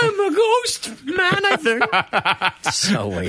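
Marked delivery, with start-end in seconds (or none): 0.0-1.8 tiny high slow ant voice; cartoon ant voice. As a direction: squeak slowly like a tiny ant. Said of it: "a cartoon sort of ant voice".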